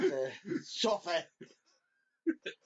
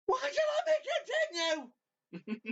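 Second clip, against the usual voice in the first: much higher, slow mock yell; hammed-up outraged shout; slower than usual. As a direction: drag it out in comic disbelief. Retold friend's outraged question.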